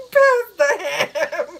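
slow, loud, high laughing words; breathless laughing. Real, not acted. Joking about the Christmas table, laughing breathlessly. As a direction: force it out through a laughing fit. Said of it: "I can't breathe".

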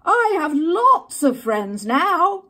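loud, slow, happy call across the pond; very loudly. Acted. Little Beaver calls happily across the pond, loud and slow. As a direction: shout it out with joy. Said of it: "very loudly".

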